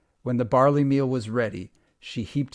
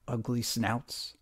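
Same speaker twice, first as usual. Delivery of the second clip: usual speaking voice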